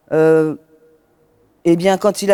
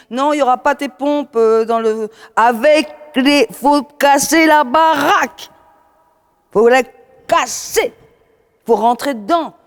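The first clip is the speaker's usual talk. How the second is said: high, loud hyped-up urging; shouting; 8 semitones higher, 3 dB louder than usual. Retold or imitated. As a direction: yell it like a fired-up coach. Retold coaches urging her on.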